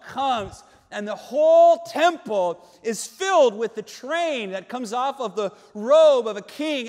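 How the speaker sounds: loud, emphatic vision-telling; full-force preaching. Acted. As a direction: paint the glory with booming intensity.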